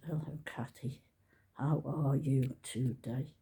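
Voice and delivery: low, soft, slow hello; soft greeting. Shown as a demonstration. Practising a soft comment for the algorithm. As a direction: ease into a gentle slow greeting. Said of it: "Let's just".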